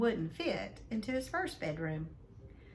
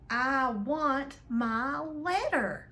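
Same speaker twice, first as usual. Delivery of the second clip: loud, very slow shouted demand; 6 semitones higher, 5 dB louder, much slower than usual. Acted. Boy shouting at his uncle for his letter, loud and slow. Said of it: "he shouted".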